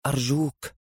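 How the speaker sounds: soft, near-collapse murmur; hushed begging on the verge of collapse; muttered plea. A narrator's reading. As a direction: plead quietly, about to break down. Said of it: "تمتم هشام وهو يوشك على الانهيار".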